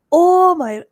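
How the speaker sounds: high, loud exclamation; retold emotional outburst. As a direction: burst out as if suddenly overwhelmed. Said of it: "I was like".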